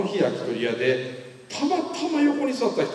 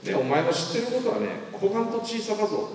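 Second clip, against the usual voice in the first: higher-pitched Kumamoto-dialect lecturing; 6 semitones higher than usual. Retold or imitated. Mentor from Kumamoto lecturing the young speaker, Kumamoto dialect. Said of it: "ちょいちょい熊本弁出るんですけど".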